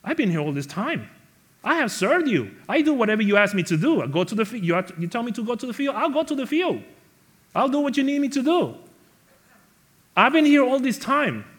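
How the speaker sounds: high, loud aggrieved complaint; whining entitlement. Retold or imitated. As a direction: sulk loudly like a spoiled son. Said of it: "that's an attitude of entitlement".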